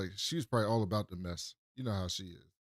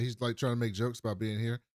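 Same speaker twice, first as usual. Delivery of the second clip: ordinary talk, nothing marked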